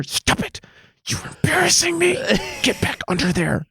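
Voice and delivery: hushed, then high scolding hiss; quiet flustered-mom scolding under the seat; flustered mom in Walmart. Pretend. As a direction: scold the dog like an embarrassed mom in a store. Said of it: "flustered mom in Walmart sound".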